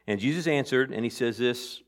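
ordinary talk, nothing marked

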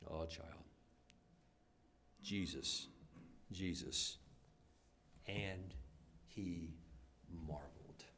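soft, slow reverent close; hushed reverence. Acted. Pastor ending the sermon softly before prayer. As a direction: let it settle quietly.

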